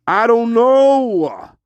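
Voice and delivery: high, drawn-out protest; mock exasperated wail. Guide answering a needling question, exasperated high wail. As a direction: stretch it into a slow, high comic wail.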